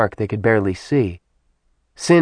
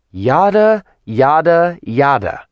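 each yada drawn out, starting much higher and falling; slowly enunciated; 6 semitones higher, 4 dB louder, slower than usual. A narrator's reading. Kat, bored with the demon's threats, slowly enunciating. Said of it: "Kat slowly enunciated each word".

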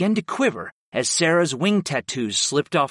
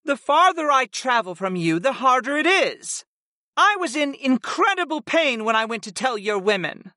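much higher, hurt goddess voice; Sarah, aggrieved; 10 semitones higher than usual. A narrator's reading. Winged servant telling her master of her pain, aggrieved. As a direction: protest your pain, pitched well up.

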